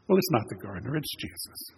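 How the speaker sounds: ordinary talk, nothing marked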